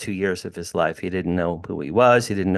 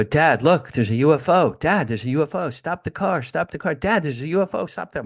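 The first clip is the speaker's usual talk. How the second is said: put-on higher, eager calls of an excited boy; a boy tugging at his dad to stop the car, raised and eager; excited kid; 6 semitones higher than usual. Teen boy begging his dad to stop the car, excitedly higher.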